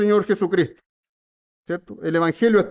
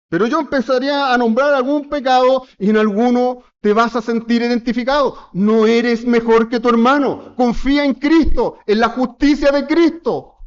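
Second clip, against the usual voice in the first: higher, impassioned sermon delivery; fervent preaching; 6 semitones higher, 4 dB louder than usual. Acted. Pastor exhorting the congregation to trust Christ. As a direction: press the point home to the church.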